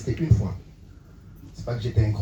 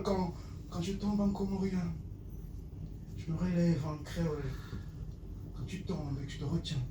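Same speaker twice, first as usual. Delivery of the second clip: hushed slam refrain; 3 semitones higher, 13 dB quieter than usual. Acted. Poet repeats his fall and rise, hushed slam. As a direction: let it fade line by line. Same lines repeated softly.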